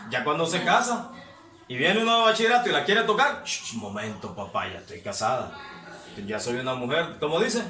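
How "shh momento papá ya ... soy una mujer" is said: much lower and softer, a dry hushed brush-off; married woman's rebuff. Retold or imitated. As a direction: shush him quietly, playing the proper married woman. Mujer casada rechaza al compañero. (mujer casada rechazando, mucho más bajo y suave)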